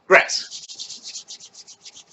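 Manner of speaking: breathy swish of hands through grass; whispered rubbing sound. Shown as a demonstration. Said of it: "making the noise".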